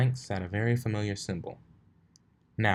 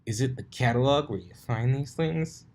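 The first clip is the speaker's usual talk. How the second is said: mocking tease; 5 semitones higher, 3 dB louder than usual. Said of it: "giggling obnoxiously".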